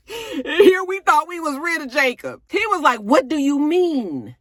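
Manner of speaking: high, loud exasperation; loud disbelief. Real, not acted. Vlogger mocking his cluelessness, high and loud. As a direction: throw up your hands, high and loud.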